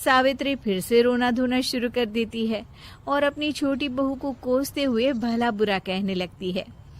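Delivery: usual speaking voice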